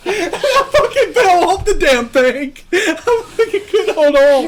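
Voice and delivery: words broken by real laughter; laughing through the story. Real, not acted. Laughing as he tells friends about falling off the ride. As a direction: laugh while telling how you fell off.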